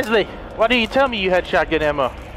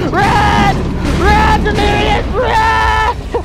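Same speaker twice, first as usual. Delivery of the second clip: very high, loud, drawn-out screams; frantic screamed urging; 12 semitones higher, 5 dB louder, much slower than usual. Real, not acted. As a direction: scream at yourself to flee. Urging himself to flee.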